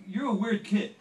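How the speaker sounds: loud, high scolding demand; loud and high, like a star catching a trespasser; hammed-up angry celebrity voice, loud and high. [name] voiced in story.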